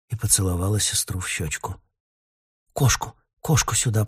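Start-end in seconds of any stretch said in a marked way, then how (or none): none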